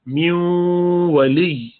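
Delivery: میو ولی with the nun hummed through the nose into the waw; slow chanted ولا نصیر, nasal merge; nasal ghunna recitation. Shown as a demonstration. Teacher chanting Quran example: nasal merge into waw. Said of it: "نون کی آواز نکالیں گے ناک سے".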